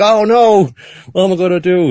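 high, loud hammed-up panic; mock panic. [name] trapped on the escalator, in high hammed-up panic. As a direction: yelp it like you're about to be strangled.

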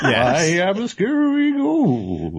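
high, slow ghost voice, laughing. Retold or imitated. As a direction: moan it like a cartoon ghost. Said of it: "literally doing ghost voice".

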